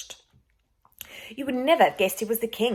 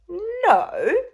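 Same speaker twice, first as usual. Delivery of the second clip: mock surprise; 12 semitones higher, 7 dB louder than usual.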